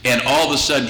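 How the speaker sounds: usual speaking voice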